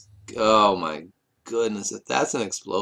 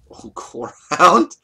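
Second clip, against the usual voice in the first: surprised laugh; 7 semitones higher, 9 dB louder, slower than usual. Real, not acted. Surprise card pull on stream.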